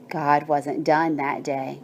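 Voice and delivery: ordinary delivery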